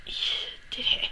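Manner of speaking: whispered little victory. Retold or imitated. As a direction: whisper it, pleased with yourself. Quiet triumph after injection.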